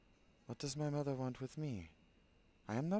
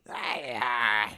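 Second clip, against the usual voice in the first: startled yelp; 13 dB louder than usual. Acted. Startled cry in battle.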